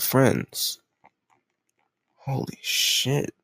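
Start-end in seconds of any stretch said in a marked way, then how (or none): 2.2-3.3 slow breathy whisper of disbelief; shocked whisper. Acted. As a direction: breathe it out, stunned. Shocked that captive has no friends.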